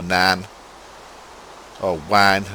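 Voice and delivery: broad Hull vowels, long and flattened; Hull accent. Shown as a demonstration. Explaining local speech to listeners, proper Hull accent. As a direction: say nine and wine the Hull way, as 'nahn' and 'wahn'. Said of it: "a proper Hull accent, you say".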